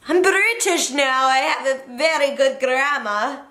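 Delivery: high, put-on British accent, badly done; mock British accent. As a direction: toss off a jokey fake British accent that drifts Irish. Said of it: "What the hell was that accent? That wasn't even British. It's, like, Irish".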